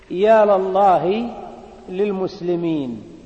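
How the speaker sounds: "يا لله" very slow, the lam of "لله" said with a clear a vowel; slow model cry "يا لله" with la, then "للمسلمين" with li; open la demo. Shown as a demonstration. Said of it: "بفتح لام المستغاث به".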